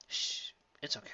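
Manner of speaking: soft, hushed comfort; calm her down gently; soothing hush. Acted.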